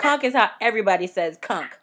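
"conch" said as "konk" both times; "conch" rhyming with "honk"; conch with hard K. Shown as a demonstration. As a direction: finish each "conch" on a K, like "kunk". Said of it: "I say words a little differently".

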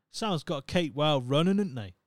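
broad Norfolk saying, dropped letters; Norfolk twang. Retold or imitated. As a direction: Norfolk yokel voice. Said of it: "being from Norfolk, we do have a certain twang".

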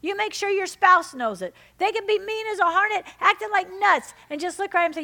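high, loud pulpit urging; fervent preaching. Acted. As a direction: urge the church with full force. Fervent exhortation to congregation.

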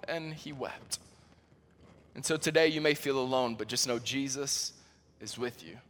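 tender hush. Real, not acted. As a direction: comfort the listener gently. Soft closing comfort.

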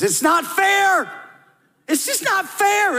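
drawn-out high whine of protest; whiny voice. Acted. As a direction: whine it like a sulking child. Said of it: "in a whiny voice".

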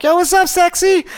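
very high, loud playful call; flirty greeting. Retold or imitated. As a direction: call out a cheeky flirty hello. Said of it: "And I'm like".

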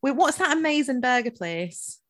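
ordinary talk, nothing marked

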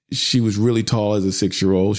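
normal talking voice

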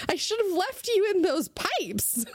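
high, slow put-on voice; mock scolding. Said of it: "what is wrong with you".

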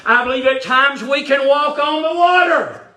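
high, building preacher's cry; full-force preaching. Real, not acted. Preacher urging congregation to step out in faith, rising high preaching.

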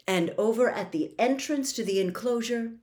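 ordinary delivery, nothing marked

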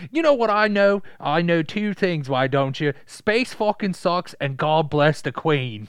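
loud, high put-on character voice; hammed-up proclamation; mock droid pronouncement. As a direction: declare it grandly and slowly, in character. Droid's outburst acted out in character.

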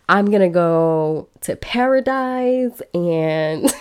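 slow words broken up by real laughter; laughing. Real, not acted. Telling her audience about the trip plan, laughing. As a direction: laugh through it, dragging the words out. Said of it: "I am cracking up".

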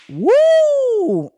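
very high, loud woo; excited whoop. Real, not acted. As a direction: let out a thrilled holler. Ogling players' thighs.

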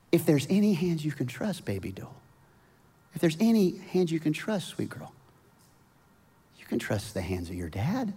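put-on calm your frightened little girl; tender reassuring dad. Dad soothing scared daughter.

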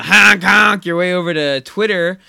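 goofy, loud honking voice; silly clown. Pretend. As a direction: ham it up like a clown. Jokes about honking right after.

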